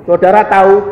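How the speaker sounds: usual speaking voice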